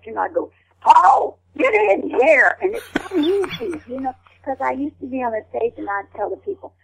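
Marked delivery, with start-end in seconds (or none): 0.8-2.6 high, loud mountain-woman holler dragged out slow; Ma Kettle holler. Retold or imitated. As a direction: mimic Ma Kettle calling Paul in from the porch. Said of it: "I used to mimic her all the time".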